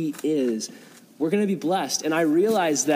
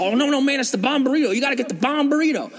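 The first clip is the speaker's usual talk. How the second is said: insistent homeless guy; 9 semitones higher than usual. Retold or imitated.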